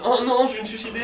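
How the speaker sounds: high, loud hammed-up panic; mock despair. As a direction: wail it like a drama queen. Mimics people overreacting to spoilers.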